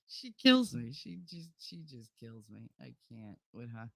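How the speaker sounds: ordinary talk, nothing marked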